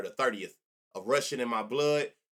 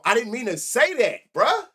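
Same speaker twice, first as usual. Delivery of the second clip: much higher and louder, voice cracking up in alarm; panicked plea; 9 semitones higher, 9 dB louder than usual. Real, not acted. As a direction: plead in real panic that it came out wrong. Realizes he misspoke on stream.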